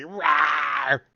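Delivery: loud growling roar; huge fiery dragon roar. Retold or imitated. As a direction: bellow it like a beast. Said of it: "He's like".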